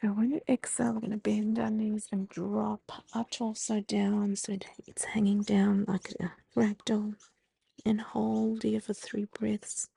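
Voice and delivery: gentle yoga instructor voice; guided breathing cues. Acted. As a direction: talk them calmly through each breath. Leads the forward fold.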